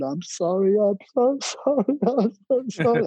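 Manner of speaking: high-pitched, hammed-up pleading apology; mock begging. Retelling how the speaker begged forgiveness, pleading. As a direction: climb high and plead, half laughing. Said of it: "I begged. I said".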